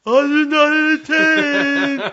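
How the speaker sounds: very high, slow, shouted character voice; Sloth. Retold or imitated. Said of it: "Now say that in Sloth's voice".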